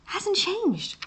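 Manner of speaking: squeaky high exasperation; high-pitched exasperated. Real, not acted. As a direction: pitch it way up, annoyed. High frustrated remark.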